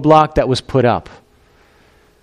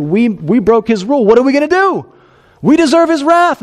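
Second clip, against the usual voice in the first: hammed-up fear, climbing; mock panic; 10 semitones higher than usual. Adam and Eve dreading God's wrath, mock panic rising.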